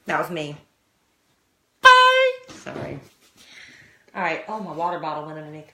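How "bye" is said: high, loud sing-song bye; waving off listeners with a loud, high bye; loud playful goodbye. Real, not acted. Signing off, playful.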